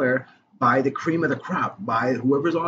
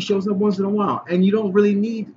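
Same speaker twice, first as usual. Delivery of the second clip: insistent rebuttal; 8 semitones higher, 5 dB louder than usual. Real, not acted. Insisting against co-host's objection.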